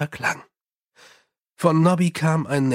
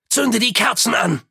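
calling out; 7 semitones higher than usual. A narrator's reading. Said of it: "rief Mumm".

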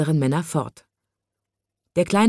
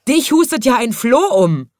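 high, loud scornful cry; mocking jeer; 7 semitones higher, 5 dB louder than usual. A narrator's reading. Big men deriding the little man, jeering loudly. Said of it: "und sie lachten".

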